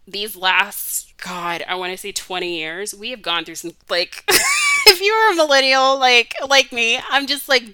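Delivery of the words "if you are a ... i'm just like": loud, high, straight out of a laugh; laughing exclamation, much louder and higher; laughing outburst. Real, not acted. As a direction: burst out laughing and talk over it.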